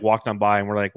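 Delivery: normal talking voice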